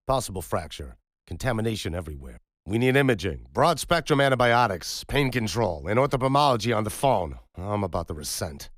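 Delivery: a trauma surgeon calling for everything at once; pressing doctor listing what the wound needs; urgent doctor. Acted. Surgeon barking urgent orders.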